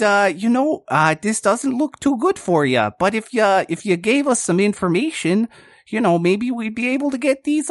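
buddy-buddy cop easing a suspect; Bortle's good cop voice. Acted. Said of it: "and he's like".

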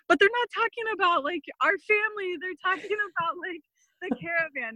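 high, urgent retold explaining; flustered.

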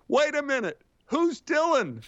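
a crew member interrupts the scene, high and confused; voice from nowhere. Retold or imitated. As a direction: pipe up high and baffled from off to the side. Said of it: "a voice comes out of nowhere saying".

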